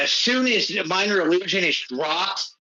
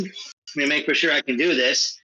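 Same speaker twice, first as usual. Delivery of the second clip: ordinary talk, nothing marked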